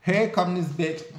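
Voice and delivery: ordinary talk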